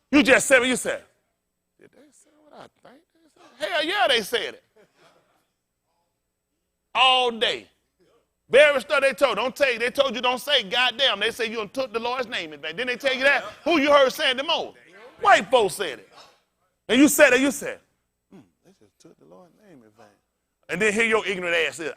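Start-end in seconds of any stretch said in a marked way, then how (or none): 1.8-3.7 hushed, mock-shocked listener. 18.3-20.1 hushed, prim churchgoer; mock pious murmur. Mocking pious listener.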